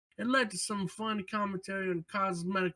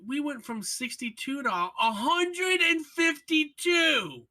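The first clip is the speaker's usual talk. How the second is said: outraged shout; 5 semitones higher, slower than usual. Real, not acted. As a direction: yell it out, stretching each number. Griping about hunter cooldown nerf.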